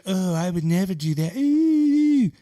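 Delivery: high, prissy mock of a snooty rich lady. Mocking the rich women on the Titanic in a prissy high voice. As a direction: put on a snooty upper-class sniff.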